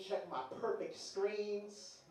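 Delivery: ordinary talk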